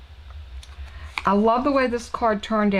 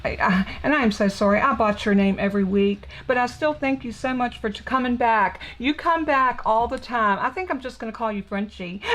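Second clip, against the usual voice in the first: ordinary delivery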